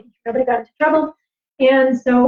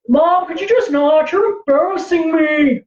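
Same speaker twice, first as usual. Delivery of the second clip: slow, drawn-out, higher complaint; imitating embarrassed son; 3 semitones higher, slower than usual. Son answering his mom's call in a store, embarrassed. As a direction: mimic a mortified kid dragging out every word. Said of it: "that's my son Cloud".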